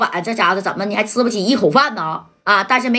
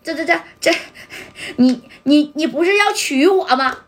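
crying; 6 semitones higher than usual. A narrator's reading. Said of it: "这梁海玲就哭啊".

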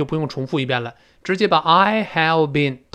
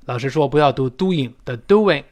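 ordinary talk

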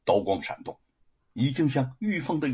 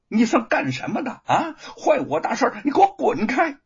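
high, loud outburst of fury; flustered angry yelling; 11 semitones higher, 5 dB louder than usual. A narrator's reading. Luo Pang to the interfering constable, flustered yelling. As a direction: yell at the meddler in exasperation. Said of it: "气急败坏地大嚷".

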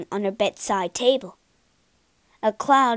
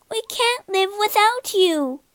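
high, wailing cry of the sisters; howling plea; 11 semitones higher, 4 dB louder than usual. A narrator's reading. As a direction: wail it out, begging. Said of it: "they howled".

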